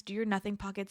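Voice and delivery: normal talking voice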